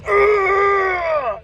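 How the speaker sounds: high shouted ugh of rage; furious frustrated groan. Retold or imitated. DOT cop fuming that the trooper let the trucker go, furious ugh. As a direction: explode in a strangled growl of anger. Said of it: "He literally was like".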